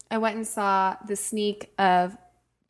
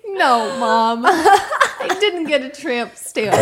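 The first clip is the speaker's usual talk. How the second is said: laughing protest; 6 semitones higher, 9 dB louder than usual. Retold or imitated. Reply to mom, laughing.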